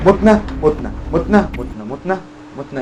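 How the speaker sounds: high, urgent repeated cry; panicked outburst. Real, not acted. Player picks wrong and is dying, high panicked outburst. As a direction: blurt it over and over in alarm.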